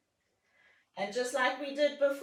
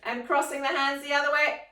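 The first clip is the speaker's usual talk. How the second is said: normal talking voice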